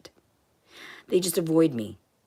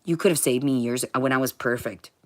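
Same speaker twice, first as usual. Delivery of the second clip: normal talking voice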